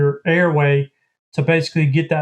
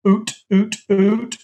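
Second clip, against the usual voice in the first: high, breathy oot oot; oot starting sounds; 5 semitones higher, slower than usual. Shown as a demonstration. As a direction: shape the oot in the back of the throat. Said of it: "is I'm saying".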